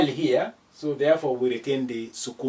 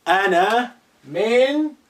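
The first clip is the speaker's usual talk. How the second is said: drawn out slowly to let students guess; slow teaching voice; 6 semitones higher, 6 dB louder, much slower than usual. Shown as a demonstration. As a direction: say it slowly like a quiz. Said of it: "whether you can guess this".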